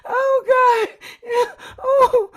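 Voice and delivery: high, wailing through laughter; helpless laugh-cry. Real, not acted. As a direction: whimper while cracking up. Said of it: "I gotta get myself together".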